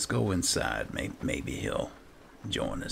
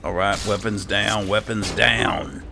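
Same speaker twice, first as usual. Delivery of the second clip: raised, loud order to stop; shouted command; 2 semitones higher, 8 dB louder than usual. Acted. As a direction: call out firmly over a fight, stretching the last words. Ordering fighting companions to stop.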